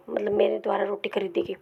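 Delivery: ordinary talk, nothing marked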